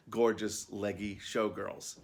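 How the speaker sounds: usual speaking voice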